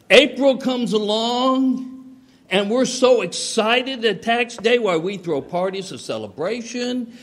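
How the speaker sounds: high, drawn-out sarcastic glee; hammed-up rejoicing, starting loud and slow; mock excitement. Preacher jokes to congregation about tax day, mock excitement.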